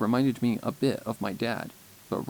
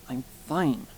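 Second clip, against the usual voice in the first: huffed; 2 semitones higher than usual. Acted. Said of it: "I huffed".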